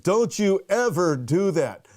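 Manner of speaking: mock-serious admonishment with emphatic stresses; stern mentor warning. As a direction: retell a mentor's emphatic "don't you dare" telling-off. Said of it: "and then he looked at me".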